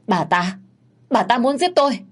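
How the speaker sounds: high frantic plea for help; terrified cry. Acted. Strangled girl crying to the young master, terrified. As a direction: cry out that she'll be killed. Hương being strangled cries out.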